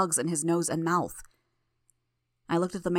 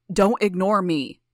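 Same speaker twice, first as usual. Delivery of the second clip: slow, loud furious yell; armed father shouting down a silent hero; angry shout; 8 dB louder, slower than usual. A narrator's reading. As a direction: bellow it, every word hammered. Mayor roaring, raising his gun.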